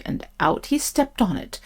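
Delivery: usual speaking voice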